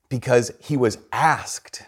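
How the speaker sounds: ordinary talk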